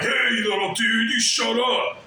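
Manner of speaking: mocking a simp acting tough; mock tough guy. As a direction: sneer down at the little dude. Imitating a big dude bullying.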